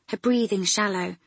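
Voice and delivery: usual speaking voice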